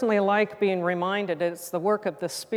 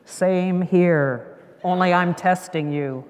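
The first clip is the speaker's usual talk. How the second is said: loud, measured divine reply; God's voice; 6 dB louder, slower than usual. Acted. God answering the frog's retreat, loud godlike voice.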